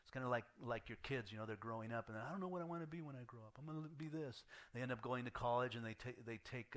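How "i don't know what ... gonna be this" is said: fast and softer, lightly mimicking a child; rushed kid voice. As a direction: rattle it off like an unsure kid. Kids voiced, unsure of future.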